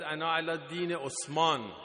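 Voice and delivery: ordinary talk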